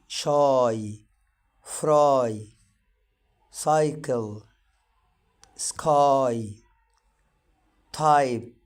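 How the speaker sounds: each word said alone, y spoken as a clear ai; modeling y as ai. Shown as a demonstration. Teacher models words for students to repeat, loud. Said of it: "تحاول تنطقها وتشوف أنت نطقتها صح".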